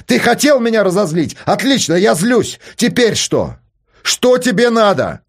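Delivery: a father finally losing his temper; angry shouting. A narrator's reading. Father's angry outburst at son. (гневный крик)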